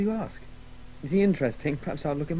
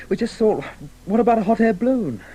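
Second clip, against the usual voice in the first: higher and louder, bursting with the idea; eager excitement; 7 semitones higher, 7 dB louder than usual. Acted. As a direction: blurt out the big plan. [name] pitching his crazy scheme.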